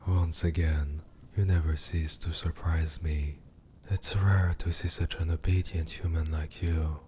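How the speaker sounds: usual speaking voice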